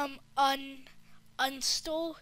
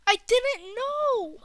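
higher, slow, put-on innocent whine; 9 semitones higher, 8 dB louder, slower than usual. Kid voicing a caught thief, high and innocent. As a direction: play the innocent, drawn out.

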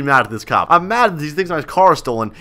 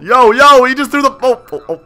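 loud, high-pitched astonishment; shocked outburst; 8 semitones higher, 7 dB louder than usual. Pretend. Inmate watching Randall throw the ball at the cop, shocked. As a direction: blurt it out, pitch shooting up.

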